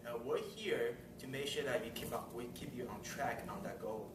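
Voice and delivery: ordinary talk, nothing marked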